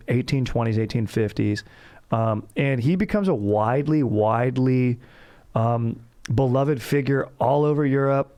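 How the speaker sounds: ordinary talk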